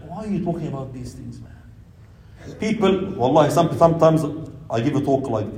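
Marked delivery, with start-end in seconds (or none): 0.1-2.5 low, fast, confidential mutter; mock discreet complaint under the breath; hushed reproach. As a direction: murmur it quickly, fading to a whisper. Imitating a listener's discreet objection.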